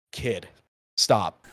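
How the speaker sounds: low, deadpan telling-off with a gap between words; weary rebuke. Retold or imitated. Older gamer to a boasting kid, weary rebuke. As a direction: shut the youngster down, low and tired.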